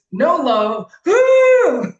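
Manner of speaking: loud high whoop; hyped-up whoop; excited. Real, not acted. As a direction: shout it out with joy. Cheering at the point.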